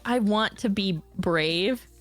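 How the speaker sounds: usual speaking voice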